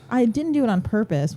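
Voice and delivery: ordinary delivery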